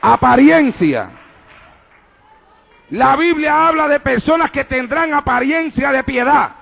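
rising, emphatic pulpit delivery; preaching at full force. Real, not acted. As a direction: proclaim it to the whole church. Predica a plena fuerza. (predicando con fervor a plena voz)

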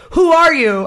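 imitated rude demand, WHO hit high and loud; mock-yelled challenge; retold abrasive yell. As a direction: mimic the abrasive passenger, leaning on "Who". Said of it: "like, yelling abrasive".

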